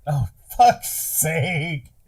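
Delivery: normal talking voice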